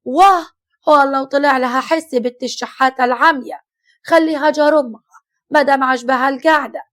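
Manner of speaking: loud, spiteful outburst; furious. Acted. Mother-in-law rants loudly about Arzaq, furious. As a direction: spit the words out in rage. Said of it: "ردت الحما بغيظ".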